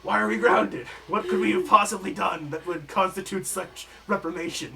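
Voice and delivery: loud, offended outrage; indignant protest. Acted. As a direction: demand an explanation, affronted. Players protest being grounded.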